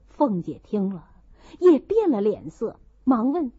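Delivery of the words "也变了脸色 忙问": ordinary delivery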